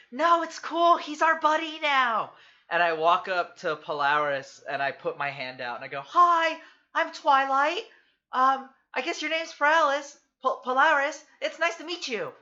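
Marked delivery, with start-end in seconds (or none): 0.1-2.3 much higher, louder cheery shout; cheerful shout back. Acted. Twilight shouting to an ally, much higher cheery call. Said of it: "I shout back". 6.0-12.3 much higher, bright eager greeting; bubbly Twilight. Acted. Twilight introducing herself to the giant, much higher eager voice.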